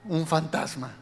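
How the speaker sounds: usual speaking voice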